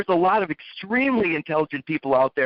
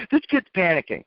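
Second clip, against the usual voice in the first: ordinary talk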